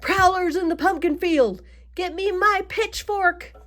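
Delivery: high, loud farmer's holler; alarmed shout. A narrator's reading. Farmer Ben spots prowlers in his pumpkin field, shouting. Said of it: "they heard Ben shout".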